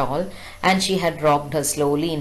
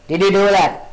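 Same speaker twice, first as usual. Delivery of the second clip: louder stern father demanding; 3 semitones higher, 6 dB louder than usual. Retold or imitated. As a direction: confront the child hard. Father confronts the child.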